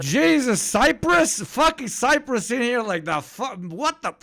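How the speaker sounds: high, loud shocked yelp; real startled shock; shocked outburst. Real, not acted. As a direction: blurt it out in sudden disbelief. Shocked reaction to news mention.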